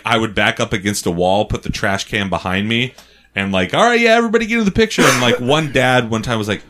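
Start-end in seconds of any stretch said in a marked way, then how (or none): 3.8-5.0 high, rushed retold enthusiasm; forced cheerful. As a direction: rush it out in a high, chirpy put-on voice. Obliging fans while hiding trash can.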